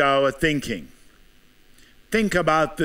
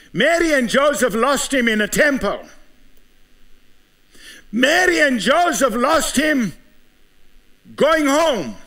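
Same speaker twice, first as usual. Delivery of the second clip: preaching at full force; 6 semitones higher than usual. Acted. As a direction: press each question on the congregation. Altar-call style questioning.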